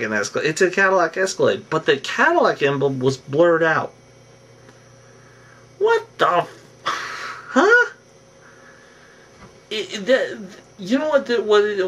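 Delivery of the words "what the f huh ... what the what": high-pitched, incredulous double take; baffled disbelief. Real, not acted. Vlogger stumped by a blurred-out car logo, incredulous. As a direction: break off, then a squeaky, baffled huh.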